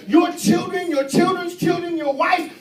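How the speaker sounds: loud, high preaching shout; preaching at full force. Acted. Preacher urging the church to fight, full-force shout. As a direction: whoop it to the congregation.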